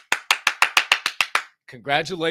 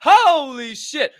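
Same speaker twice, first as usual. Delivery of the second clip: slow, louder, said through laughter; laughing; 11 semitones higher, 9 dB louder, much slower than usual. Real, not acted. Still laughing at the prize news, holy shit through laughter. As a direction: let the laugh carry the words.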